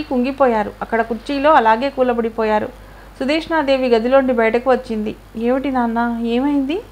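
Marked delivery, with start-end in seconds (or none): none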